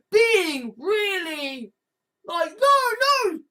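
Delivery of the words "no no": high-pitched mock indignation; defensive protest. Fans defend the program against attack, defensive protest. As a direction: protest high, as an affronted fan. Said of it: "people defending it".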